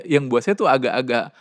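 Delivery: ordinary talk, nothing marked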